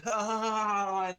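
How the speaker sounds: high, loud cackling ha ha ha; mocking laughter. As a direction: cackle like a crowd of jeering voices. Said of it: "and just, like, laughing".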